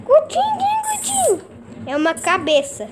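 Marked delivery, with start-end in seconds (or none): 0.1-1.4 much higher squeaky little voice; squeaky Gutinho voice. Pretend. Streamer asking who Gutinho is, in a squeaky voice. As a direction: pipe up like a tiny creature. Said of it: "É a voz do Gutinho".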